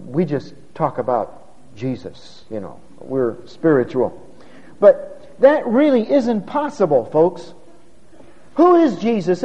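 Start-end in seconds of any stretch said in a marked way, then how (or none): 0.1-4.2 hushed, mock-pious tone. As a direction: say it with a faraway holier-than-thou air. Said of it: "I always have to have a pious tone of voice".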